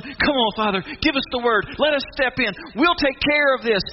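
high, urgent plea; eager pleading. Acted. Angels pleading with the Father to step in, urgent. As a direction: beg to be let loose.

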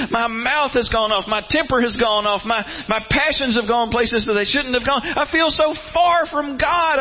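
high, pleading confession of failure; guilt-ridden lament. Retold or imitated. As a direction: sound like a believer who feels unworthy. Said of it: "when we're going".

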